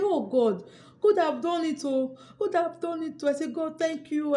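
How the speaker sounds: high-pitched, elated praise to God; retold joy. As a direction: exclaim thanks in high-voiced delight. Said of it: "I was very happy".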